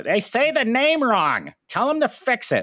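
mock angry caller.

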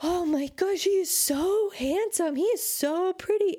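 high, breathy mock gush over a cute dog; gushing stranger. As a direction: coo like a stranger smitten with the dog.

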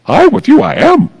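a light, high-pitched imitation of a wise little master with words out of order; Yoda speak. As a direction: playfully mimic a tiny sage's backwards phrasing. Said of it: "that sounds like Yoda speak".

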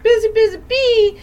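high squeaky busy-bee voice; squeaky singsong. Retold or imitated. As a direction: chirp it way up high. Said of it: "it was just like".

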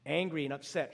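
ordinary delivery

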